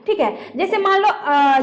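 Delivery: normal talking voice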